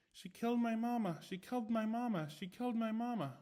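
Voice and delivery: high, fading doll chant; repeating doll. A narrator's reading. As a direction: loop it like a broken toy. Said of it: "it just kept repeating".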